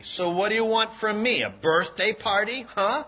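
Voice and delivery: mock her birthday wish with contempt; nasty retort. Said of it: "Her friend replied nastily".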